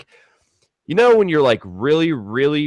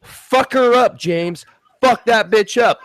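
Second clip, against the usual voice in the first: high, loud retold yelling of a girlfriend; imitated screaming; 8 semitones higher, 3 dB louder than usual. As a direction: shriek it like she's egging on a fight. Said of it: "Kim is screaming at me".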